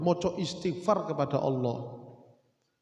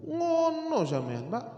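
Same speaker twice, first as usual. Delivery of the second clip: usual speaking voice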